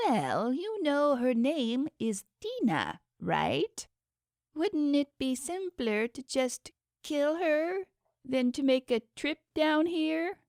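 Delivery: a vain god sneering at the gadget; dragging out technology with sarcasm; scheming underworld god. A narrator's reading. God Zecheron voiced by narrator.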